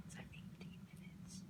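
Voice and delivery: hushed aside, barely voiced; whispering. Real, not acted. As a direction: whisper it so the mic barely hears. Whispering aside during filming.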